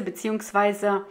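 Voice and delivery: normal talking voice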